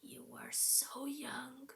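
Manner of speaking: slow, soft, almost a whisper; pitying whisper. Acted. Old woman pities the young girl, whispered slow. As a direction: sigh it with old pity. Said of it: "said the old woman".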